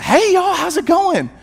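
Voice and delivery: very high-pitched, sing-out greeting; imitating chirpy wife. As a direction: mimic an introverted wife suddenly turned bubbly. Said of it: "she comes in, she said".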